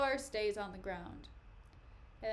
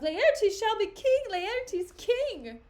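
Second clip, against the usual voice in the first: high, loud mob cheer; rousing, raised-pitch chant; crowd acclamation; 10 semitones higher, 10 dB louder, slower than usual. Acted. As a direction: hail the new king at full voice. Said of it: "tongues applaud it to the clouds".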